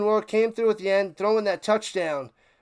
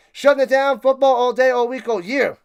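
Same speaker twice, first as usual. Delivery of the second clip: hype shout; 4 semitones higher, 6 dB louder than usual. Real, not acted.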